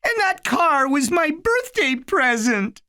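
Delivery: put-on high, small child's voice; a scared little kid, pitched high; little boy voice. A narrator's reading. Little Eddie pleading with his big brother, high childlike voice.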